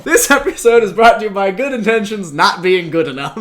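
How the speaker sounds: high, loud mock announcer read; fake sponsor read. Host pitching a fake sponsor bit, mock announcer. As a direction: announce it brightly, laughter close behind. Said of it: "the whole fake sponsors bit".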